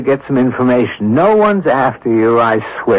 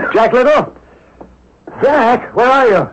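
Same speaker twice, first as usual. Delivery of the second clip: calling out; 10 semitones higher than usual. Acted. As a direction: call out to find him. Calling for friend in building.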